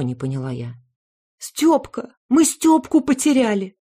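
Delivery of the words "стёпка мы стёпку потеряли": high, loud alarmed cry; panicked cry. A narrator's reading. Mother telling the hostess her son is lost, panicked cry.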